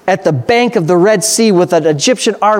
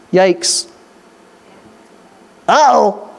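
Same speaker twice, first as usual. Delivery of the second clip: a light mock Chris Rock uh-oh; Chris Rock impression; slower than usual. Preacher jokes to the congregation, lightly imitating Chris Rock. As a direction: toss off a comic uh-oh in Chris Rock's manner. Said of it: "that's my best Chris Rock impression".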